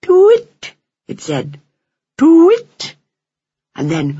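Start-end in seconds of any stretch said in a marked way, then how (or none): 0.0-2.9 high, loud owl "twit" rising at the end; eerie owl call. A narrator's reading. Owl calling from a hedgerow tree, eerie. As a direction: hoot like an eerie tawny owl. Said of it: "wavering call sounded from".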